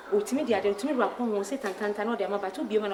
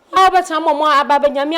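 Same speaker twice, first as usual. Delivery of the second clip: scolding outburst; 5 semitones higher, 7 dB louder than usual. Acted.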